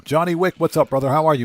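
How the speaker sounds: ordinary talk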